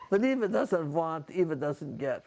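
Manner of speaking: imitated Bulgarian accent, "want" as "vant"; Eva's Bulgarian accent. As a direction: relish the catchphrase in a Bulgarian accent. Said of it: "I love that".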